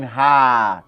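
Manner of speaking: ordinary talk, nothing marked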